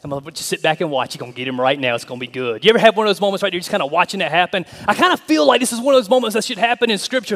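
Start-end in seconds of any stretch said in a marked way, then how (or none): none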